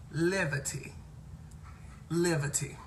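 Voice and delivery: "Levity" for liberty, b turned to v; mispronounced liberty. Retold or imitated. Quoting man at church, liberty mispronounced "Levity". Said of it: "He said ministry and liberty".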